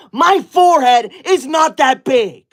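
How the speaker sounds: high, loud, slow outraged denial; indignant protest. Acted. Streamer answering forehead insult, indignant protest. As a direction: spell it out, deeply offended.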